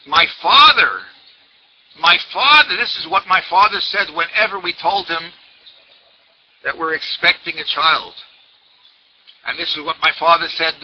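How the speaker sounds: excited retold outburst; mock scream. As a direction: exclaim it with sudden recognition. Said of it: "And the lady screamed".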